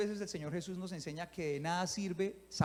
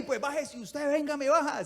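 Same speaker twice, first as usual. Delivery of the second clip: high, hammed-up challenge thrown back; mock angry comeback; 7 semitones higher, 5 dB louder than usual. Jesus imagined daring the mocking Pharisees, mock defiant. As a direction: dare them to come get you. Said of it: "Hubiera empezado el Señor Jesús".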